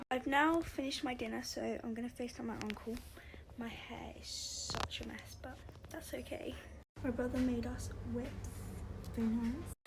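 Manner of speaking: soft unvoiced whisper; hushed late-night vlog whisper. Real, not acted. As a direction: whisper so no one wakes.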